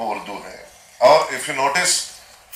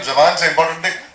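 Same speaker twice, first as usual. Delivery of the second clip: ordinary delivery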